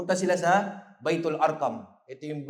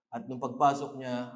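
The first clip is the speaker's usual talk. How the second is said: usual speaking voice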